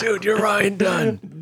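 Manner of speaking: put-on slow, high slurring drunk dude; drunk bro voice. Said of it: "I like your drunk bro voice".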